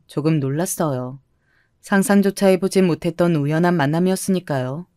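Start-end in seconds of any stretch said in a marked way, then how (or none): none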